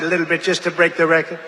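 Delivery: ordinary talk, nothing marked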